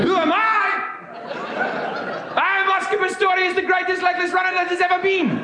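high, bombastic South African boasting; South African accent. Acted. Runner declares who he is to girlfriend, bombastic South African accent. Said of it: "I will now be doing a South African accent".

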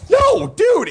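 high, loud no dude; loud protest. Real, not acted. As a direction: shout it out in protest. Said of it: "I was already pissed off".

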